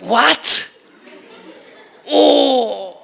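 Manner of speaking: rising gasps of dawning surprise; sudden realization. Acted. A listener who finally understands, in stunned surprise. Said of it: "you would say".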